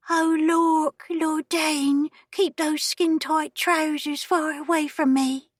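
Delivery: high, breathy mocking imitation; soft breathy voice. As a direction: breathe it out like a naive girl. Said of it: "in a soft, breathy voice".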